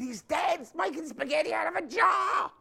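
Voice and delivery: high-pitched mock Italian accent. Preacher telling the Prego commercial, mock Italian accent. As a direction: exaggerate an Italian lilt, rising high. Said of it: "this Italian guy goes home".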